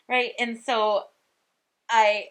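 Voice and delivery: usual speaking voice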